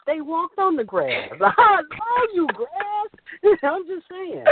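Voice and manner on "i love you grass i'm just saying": giddy laughing. Real, not acted. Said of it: "got me all emotional".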